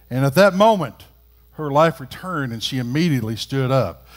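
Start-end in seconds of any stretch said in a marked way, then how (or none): none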